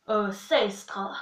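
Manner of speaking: normal talking voice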